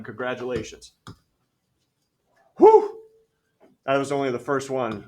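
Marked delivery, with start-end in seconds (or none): 2.6-3.0 big high loud whew of exhaustion. Real, not acted. Teacher finishing the first problem, loud whew. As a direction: blow out a huge relieved whew. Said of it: "I'm out of breath".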